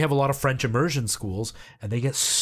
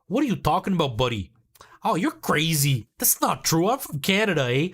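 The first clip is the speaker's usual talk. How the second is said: hammed-up, higher-pitched Canadian accent; 4 semitones higher than usual. Shown as a demonstration. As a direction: parody a Canadian "buddy" and "eh" accent. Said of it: "they picture I would call everybody buddy".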